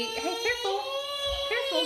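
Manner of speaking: high urgent careful; sharp warning. Real, not acted. Warning child near hot plate.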